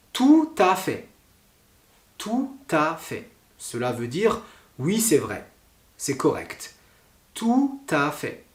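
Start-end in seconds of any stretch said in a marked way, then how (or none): 0.1-1.1 "Tout à fait" with the T of "Tout" sounded onto "à"; T liaison. Shown as a demonstration. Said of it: "Qui a un exemple avec le T à la fin?". 7.3-8.4 slow, higher "Tout à fait" with the T sounded; careful demo, the T of "Tout" joined to "à"; slow T liaison. Shown as a demonstration. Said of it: "le tout, T O U T, et ensuite A".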